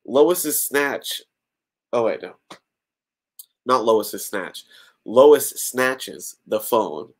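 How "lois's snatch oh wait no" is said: plain reading voice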